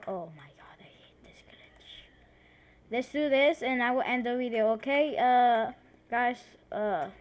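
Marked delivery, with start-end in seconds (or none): 0.1-2.1 annoyed whisper under the breath. Real, not acted. Gamer whispering at a glitch, annoyed whisper. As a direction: hiss it to yourself, irritated.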